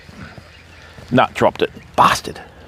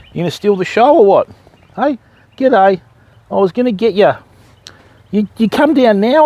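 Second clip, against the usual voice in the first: ordinary talk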